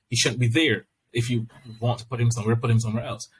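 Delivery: normal talking voice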